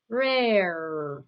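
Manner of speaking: "Rare" drawn out with a long R at both ends; "Rare" slowly sounded out for a learner; emphasised R sounds. Shown as a demonstration. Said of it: "It has it twice".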